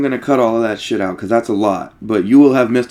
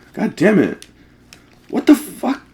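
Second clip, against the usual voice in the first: frustrated; 10 semitones higher than usual. Real, not acted. Streamer frustrated at game.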